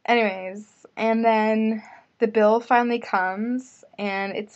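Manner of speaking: ordinary talk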